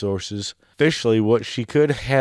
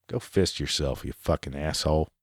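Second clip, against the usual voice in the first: low muttered insult; 6 semitones lower, 7 dB quieter than usual. Real, not acted. Host muttering a curse at the offended intern, low and soft. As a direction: lower and softer, a grumbled curse.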